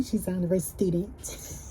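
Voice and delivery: soft, breathy put-on voice for the brag; changed voice. Real, not acted. Bragging about her daughter, in a soft put-on voice. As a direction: hush the boast into a playful put-on voice. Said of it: "I changed my voice like that".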